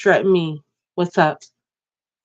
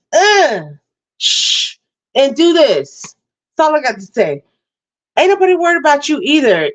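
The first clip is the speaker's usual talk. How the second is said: raised, heated rant at a hater; angry rant; 6 semitones higher than usual. Real, not acted. Challenging viewers in her live chat.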